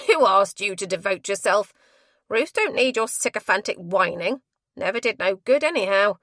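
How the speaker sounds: loud, contemptuous matron; snorting retort. A narrator's reading. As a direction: scoff her down.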